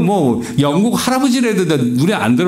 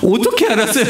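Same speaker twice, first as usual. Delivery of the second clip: high, laughing retelling of the blurt; giggling mock-innocent question; laughing replay; 8 semitones higher, faster than usual. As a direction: laugh through the naive question. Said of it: "그런 질문을 하면 안 되는데".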